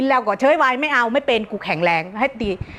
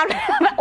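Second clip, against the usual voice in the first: squealing high laugh; sudden high giggle. Real, not acted. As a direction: let out a shrill burst of laughter. Laughing at a viewer's reply.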